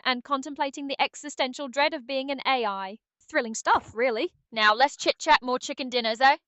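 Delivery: put-on dry, witty British accent; British Aria. Game sidekick teasing the player, dry British accent. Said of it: "how was British Aria's day?".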